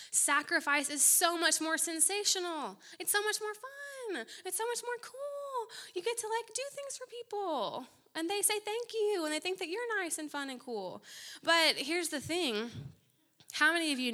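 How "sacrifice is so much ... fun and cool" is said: much higher, gushing and bubbly; gushing mock enthusiasm. Preacher mocking showy sacrifice with gushing glee. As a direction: sell the fun of it like a giddy fan.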